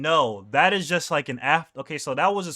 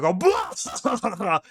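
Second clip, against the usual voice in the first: Garp laugh; 6 semitones higher than usual. Retold or imitated. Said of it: "you see a Garp laugh".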